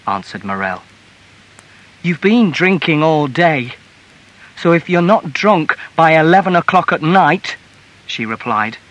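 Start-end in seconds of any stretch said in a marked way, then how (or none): 2.0-7.5 raised, higher voice of a scolding wife; heated reproach; angry accusation. A narrator's reading. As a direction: lay into him, loudly. Wife accusing husband in quarrel.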